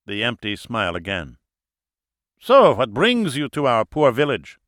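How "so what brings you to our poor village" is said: put-on high, loud German-accented voice; German host, jovial. A narrator's reading. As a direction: introduce yourself with booming German pride. German character questions visitors.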